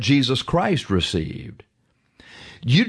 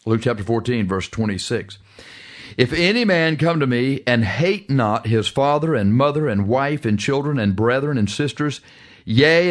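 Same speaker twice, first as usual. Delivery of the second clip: ordinary delivery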